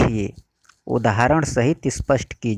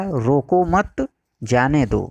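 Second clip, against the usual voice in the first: रोको मत run together, pause, then जाने दो; pause after मत; slower than usual. Shown as a demonstration. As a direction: break after मत, slowly setting जाने दो apart. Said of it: "और दूसरा".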